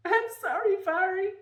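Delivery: high, breathless gasp of I'm sorry; gasping apology. Acted. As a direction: choke it out mid-sob. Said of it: "I gasped".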